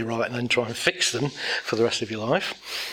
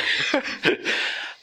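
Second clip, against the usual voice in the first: unvoiced chuckle; 7 semitones higher than usual. Real, not acted. Laughs at himself.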